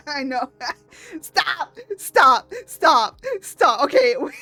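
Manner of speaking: normal talking voice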